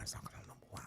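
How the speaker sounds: "As long as I'm number one" in a breathy whisper with no voice. Real, not acted. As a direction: whisper it like a secret to yourself. Repeats line, then whispers it.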